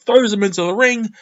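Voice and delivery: ordinary delivery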